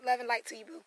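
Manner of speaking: normal talking voice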